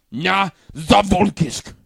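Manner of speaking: loud, slow mock Russian toast; mock Russian accent. Host finishing the blurb with a toast, mock Russian. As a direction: boom it out in fake Russian.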